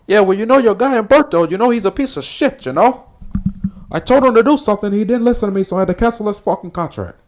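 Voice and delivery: high, loud, hammed-up gangster caricature; mock gangster griping on the phone; Tony from Scarface. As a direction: complain in a high, strained movie-gangster voice. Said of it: "on the phone with Sosa".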